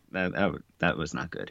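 ordinary talk, nothing marked

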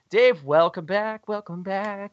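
host welcoming Dave back, sing-song. Real, not acted. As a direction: greet him like a long-lost friend. Greeting returning co-host.

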